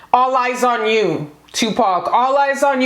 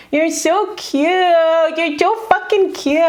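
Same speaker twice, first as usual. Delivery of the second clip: cooing; 6 semitones higher than usual. Real, not acted. Cooing at cute ghost card.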